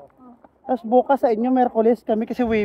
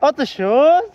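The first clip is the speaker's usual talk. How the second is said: mock French cashier; 3 semitones higher, 8 dB louder than usual. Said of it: "Try ko kayo take order ng ganun".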